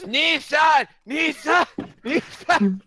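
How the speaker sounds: shouted high ringing cries; mock phone ring. Friend imitates a phone ringing, loud and high. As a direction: chant the name like a ringing phone. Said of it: "just goes".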